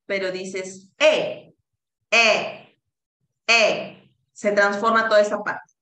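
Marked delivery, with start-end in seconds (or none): 2.1-4.0 E dicha con la boca abierta como para A; vowel between A and E. Shown as a demonstration. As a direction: abre la boca para A pero di E, más fuerte. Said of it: "abres la boca como para decir A, pero dices E". (vocal E con boca de A)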